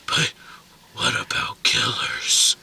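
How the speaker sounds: slow breathy whisper; movie whisper. Retold or imitated. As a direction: hush the grievance out slowly. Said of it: "a breathy whisper".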